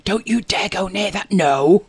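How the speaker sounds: urgent acted whisper, firmer on the last word; whispered warning. Owner warning the dog outside sleeping parents' bedroom, urgent whisper. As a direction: whisper a stern telling-off. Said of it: "So I'm whispering to him".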